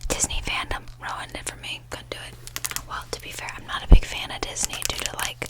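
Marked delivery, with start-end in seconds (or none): none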